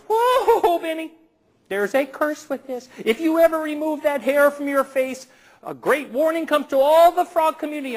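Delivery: a high, theatrical fairy issuing a curse; fairy frog mother. Acted. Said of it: "The fairy frog mother goes".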